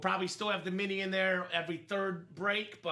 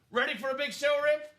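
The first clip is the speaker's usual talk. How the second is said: coughing; 7 semitones higher, 4 dB louder, faster than usual. Real, not acted. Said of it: "I'm coughing 'cause I'm excited".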